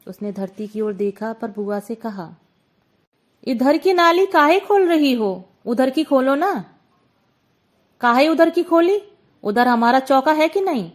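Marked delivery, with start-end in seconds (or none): 3.4-6.7 raised voice, pleading upward at the roof; raised complaint. Acted. Hunchbacked shopkeeper calling up to the aunt, raised protest. Gulki pleads with Bua below. 8.0-10.9 louder, quarrelsome snap back; sharp retort. Acted. Aunt on the roof snapping back at Gulki, quarrelsome.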